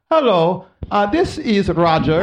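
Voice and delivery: mock Indian call-center accent; imitated Indian accent. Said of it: "place in India, and the guy's like".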